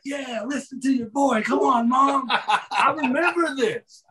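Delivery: high, laughing wrestler voice urging mom; Michael Hayes coaxing. Retold or imitated. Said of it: "And Michael's like".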